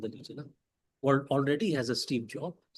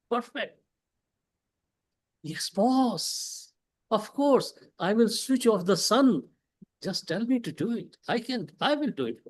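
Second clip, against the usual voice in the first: mock fawning employee, pitched up; eager yes-man; 5 semitones higher than usual. Employee flattering his boss, mock fawning and pitched up. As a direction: gush agreement at the boss like a flatterer. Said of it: "means saying".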